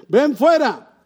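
high, loud "Ven fuera"; commanding call. Acted. God's voice calling people out of death, commanding call.

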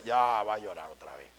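low, weary mocking grumble; bored dismissive sneer. A scoffer grumbling low at someone crying again. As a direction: roll your eyes and drag it out low.

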